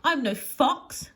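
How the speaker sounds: high, loud, drawn-out protest; indignant cry. A narrator's reading. Nessie protesting to the chasing hounds, crying out. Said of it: "cries Nessie".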